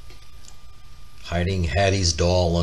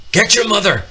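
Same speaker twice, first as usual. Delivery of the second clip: much higher and louder, a panicked yell; urgent shout; 9 semitones higher, 6 dB louder, faster than usual. Acted. Papa runs in warning his children, a panicked higher, louder shout. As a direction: shout the warning as you run in.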